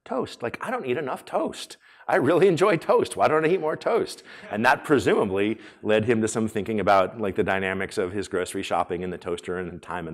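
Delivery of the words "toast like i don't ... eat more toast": high-pitched, mock-earnest plea; imitated friend's complaint. Speaker quoting a friend's bug list, high and mock-earnest. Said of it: "one of his was like".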